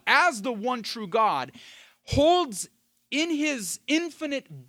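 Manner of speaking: high, loud proclamation with punched key words; impassioned preaching. Real, not acted. Preacher exalting Yahweh to the congregation, impassioned. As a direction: build it up forcefully for the congregation.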